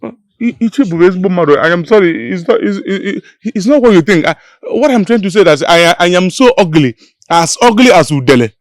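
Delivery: high, loud stammering backpedal; frantic loud apology. Acted. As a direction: scramble to undo the insult.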